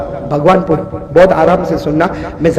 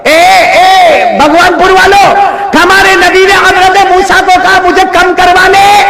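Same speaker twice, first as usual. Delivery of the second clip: raised, ringing sermon voice; preaching at full force; 12 semitones higher, 5 dB louder than usual. Acted. Preacher retelling the Prophet's plea to a village crowd, ringing and raised.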